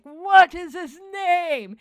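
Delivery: high, loud, drawn-out dramatic cry; big hammed-up hero-entrance line; mock-epic exclamation. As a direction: belt it slowly like a grand hero reveal.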